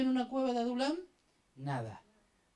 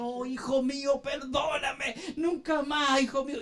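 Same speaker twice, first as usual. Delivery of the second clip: hammed-up weeping apology, pitched higher; mock tearful pleading; 4 semitones higher than usual. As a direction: wail for forgiveness like a remorseful father. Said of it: "Se ponía a llorar y decía".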